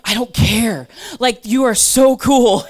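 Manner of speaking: loud, gushing, starstruck fan voice; gushing fangirl. Retold or imitated. Gushing to a movie star as a starstruck fan. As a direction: gush like a teen meeting her idol. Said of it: "I was fangirling hardcore".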